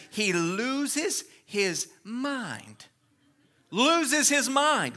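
stretched out slowly, word by word; slow emphasis. Real, not acted. Preacher to congregation, dragging it out slowly. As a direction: drag out loses his mind for effect.

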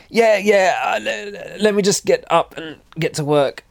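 high, stammering imitation of a hurt rider; injured. Crashed rider brushing off a helper, injured voice. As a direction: insist you're fine in a high, hurt voice. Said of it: "I was incredibly injured at that point".

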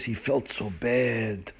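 soft, low, very slow; hushed, drawn-out sorry telling; remorseful. A narrator's reading. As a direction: let it sink with regret. Narrator voices the friend's remorse.

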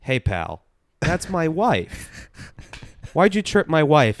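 loud mock-confrontational husband, rising in pitch; imitating Sam Hyde. Friend acts out the husband accusing the host, loud mock confrontation. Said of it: "him going like".

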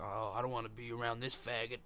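low grumbled aside; retold muttered disgust. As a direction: imitate a guy dropping the fight. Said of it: "'cause he's like".

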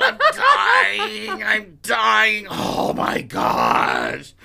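high, loud, laughing wail of agony; dramatic sick man. Retold or imitated. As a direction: ham up dying of a cold. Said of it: "Willie gets sick".

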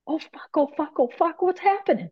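high, panicked swearing to her guides; a rising inner panic, caught off guard; panicked inner cry. Retold or imitated. To her guides as the energy takes over, high panicked swearing. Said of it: "I'm in my head going".

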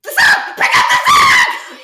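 high, loud, slow furious mock scream; pissed yell. Phoebe yelling at friends to pick up the sock, furious mock scream. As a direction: scream the order, fed up. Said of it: "She's so pissed".

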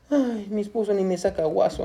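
soft, sighing complaint; envious wife. Acted. Preacher voices a wife seeing vacation photos, quiet sighing grumble.

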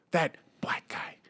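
hushed, slow mock whisper. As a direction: whisper it like a forbidden word. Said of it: "like, they whisper that".

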